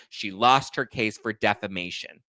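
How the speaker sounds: ordinary talk, nothing marked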